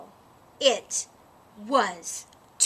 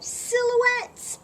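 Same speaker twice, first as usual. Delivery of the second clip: high eager; 11 semitones higher, 4 dB louder than usual. A narrator's reading. Bunny names her idea.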